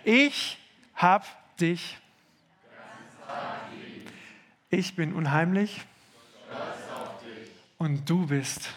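very slow, limp lead line; heat-weary version. Shown as a demonstration. Pastor mocking the sleepy crowd, slow and limp. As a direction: drag it out like it's thirty degrees. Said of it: "die dreißig Grad Version um elf Uhr".

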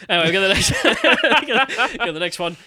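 loud burst of laughter through the words; real, helpless laugh breaking in. Real, not acted. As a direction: try to move on while cracking up. Cracks up while moving on.

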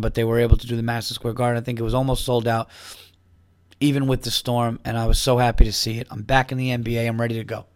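ordinary talk, nothing marked

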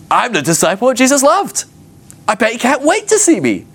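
high-pitched, hammed-up delight at being loved; gleeful self-assurance. Disciple boasting that Jesus loves him, gleeful self-assurance. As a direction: play a thrilled, adored favourite.

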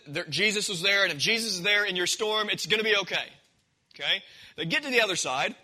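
usual speaking voice

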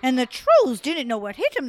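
plain reading voice

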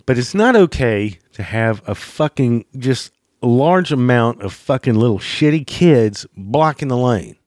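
ordinary talk, nothing marked

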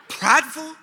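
high, rising "Prideful" in mock outrage; incredulous. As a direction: squeak up on "Prideful" as an offended question. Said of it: "That's what I said".

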